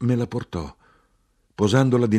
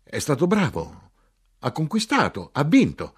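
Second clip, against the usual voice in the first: high, insistent outburst; emphatic cries in short bursts; shouting; 7 semitones higher, 4 dB louder than usual. A narrator's reading. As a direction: build to a loud final claim.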